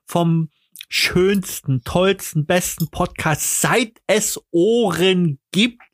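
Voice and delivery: high, slow, grand announcer voice; hyped podcast sign-off. Acted. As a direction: announce it like a showman.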